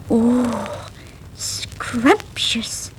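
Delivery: soft whispered scrumptious; breathy delight. Acted. Country mouse marvelling at town food, whispered. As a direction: whisper it in awe. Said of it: "said Miranda".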